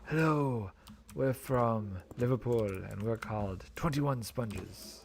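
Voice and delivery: mock Liverpool band voice, slowed; a wobbly fake Liverpool accent; playful fake British rocker intro; vague British accent. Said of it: "I don't know what that accent was".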